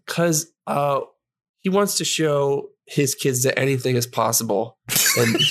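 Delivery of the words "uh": usual speaking voice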